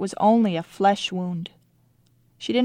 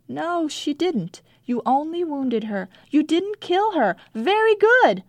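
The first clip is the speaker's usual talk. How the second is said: encouraging; 8 semitones higher than usual. A narrator's reading. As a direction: lift them up bit by bit. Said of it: "I tried to be encouraging".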